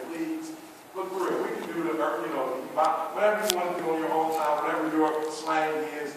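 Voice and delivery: usual speaking voice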